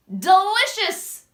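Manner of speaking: ordinary delivery, nothing marked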